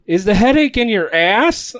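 loud, teasing call-out; cheeky shout. Retold or imitated. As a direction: sister mocking the teacher. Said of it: "And she goes".

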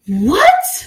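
high, loud, rising disbelief; incredulous what. Real, not acted. Host reacting to the book, loud high disbelief. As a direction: react like you can't believe it.

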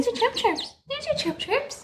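very high, soft cooing. Real, not acted. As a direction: coo gently at the chick. High soft baby talk to duckling.

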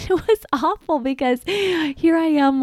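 high, laughing through the words; giggling as you recall the mess. Real, not acted. Laughing while recalling the accident.

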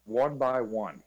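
usual speaking voice

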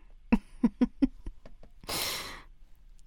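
breathy giggle; nervous laugh. Acted. Friend giggles nervously before a confession, nervous laugh. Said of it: "I'm nervous laughing".

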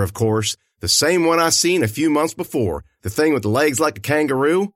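plain reading voice

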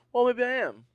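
acted self-reassurance. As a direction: pipe up as the lone wanderer. Answers own thought in scary story.

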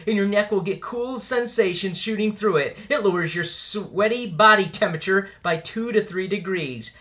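upbeat commercial pitch; ad read. Acted. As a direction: sell it like a radio ad. Sponsor ad read.

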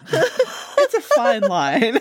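slow, high, drawn out through laughter; laughing. Real, not acted. Host debating rude versus assertive ants, laughing through it. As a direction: say it while cracking up.

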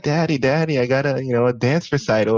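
a hint of an eager child's voice; young daughter. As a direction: imitate a daughter calling on her dad. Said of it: "if she's like".

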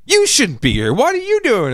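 turned-around accusation. Retold or imitated. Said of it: "You're like".

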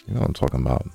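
ordinary talk

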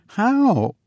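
put-on high girlish question. A narrator's reading. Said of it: "asked the girl".

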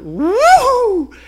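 very high, loud squeal; squeaky woo. Acted. Ants jumping off burning Ryan, squealing.

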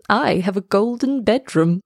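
ordinary delivery, nothing marked

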